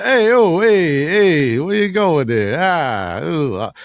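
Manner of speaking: a hint of a Brooklyn tough guy; mock Brooklyn accent. Joking about Italians in a light Brooklyn accent. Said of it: "that's a Brooklyn accent that you're doing".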